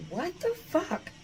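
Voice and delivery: high, slow shocked exclamation. Retold or imitated. Shocked at her own reflection, high and slow. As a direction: gasp it out in disbelief at the mirror. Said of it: "and I'm like".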